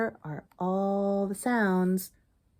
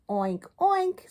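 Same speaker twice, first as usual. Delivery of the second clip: high playful oink oink; hammed-up pig oinks; 10 semitones higher, 4 dB louder than usual. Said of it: "said Duddle".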